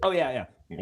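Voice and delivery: ordinary talk, nothing marked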